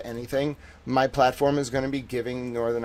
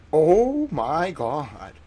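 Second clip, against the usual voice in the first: 1 drawn-out shock; 3 semitones higher, 5 dB louder, much slower than usual. Pretend. Imagined shock at finding a ring.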